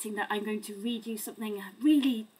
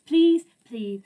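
loud pleading; 9 dB louder than usual. Acted. Reader begs to stop.

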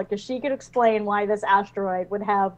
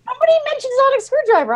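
pitched-up fast mock protest; high fast protest; 13 semitones higher, 5 dB louder, faster than usual. Real, not acted. To her co-host, defensive and high-pitched. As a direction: squeak it out defensively.

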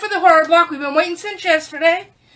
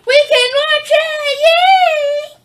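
high, loud gleeful cheer, slow at first; joyful squeal of yay; delighted cheer; 11 semitones higher, 5 dB louder, much slower than usual. Real, not acted. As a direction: burst out with glee. Delight at finding the DVD.

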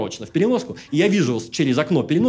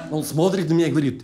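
ordinary delivery

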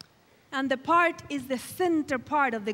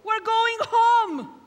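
high, loud, drawn-out jubilation; exultant shouted cheer; woohoo cheer; 8 semitones higher, 7 dB louder, much slower than usual. Shown as a demonstration. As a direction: whoop it out and stretch the words in celebration. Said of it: "I want the woohoo!".

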